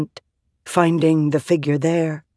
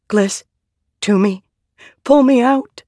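higher-pitched, voiced stage whisper, rising in panic; panicked whisper; 8 semitones higher, 4 dB louder than usual. A narrator's reading. Shallan begging to be pulled out, panicked whisper. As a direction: plead for rescue, pitch climbing to the last words. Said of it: "Panicked, she whispered".

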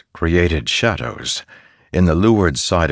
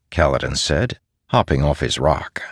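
plain reading voice

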